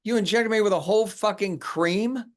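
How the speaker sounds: furious at the absurd attack; shrieking incredulity; outraged disbelief. Acted. Sees the figure, in semi-shock.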